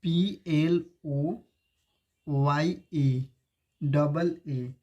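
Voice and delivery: letters spelt out very slowly, one by one; slow spelling. Shown as a demonstration. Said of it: "ई एम".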